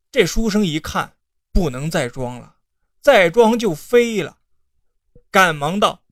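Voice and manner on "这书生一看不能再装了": plain reading voice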